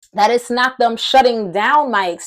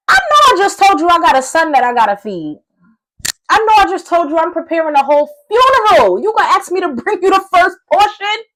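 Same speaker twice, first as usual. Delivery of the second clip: higher, louder, affronted retort; indignant protest; 6 semitones higher, 5 dB louder, faster than usual. Acted. Widow objecting to the prophet's demand, acted indignantly. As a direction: push back in disbelief with rising pitch.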